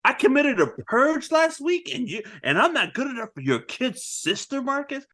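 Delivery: high-pitched incredulous outrage; mock indignation. Mike offended he's not good enough, hammed-up indignation. Acts Mike's offence at Marcus.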